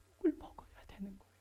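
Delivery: hushed whisper, stress on 욕을; preacher whispering that you must take the insults; whispered point. Real, not acted. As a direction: whisper 욕을 like a secret.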